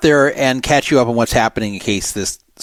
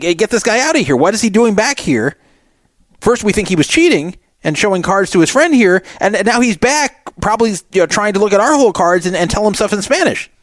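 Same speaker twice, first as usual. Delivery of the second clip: higher, raised voice demanding the floor act; raised exasperated complaint; 8 semitones higher than usual. Retold or imitated. Commentator voicing Mike complaining to the floor, raised. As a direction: sound fed up and urgent, pitched up. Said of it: "Mike Holtz is like".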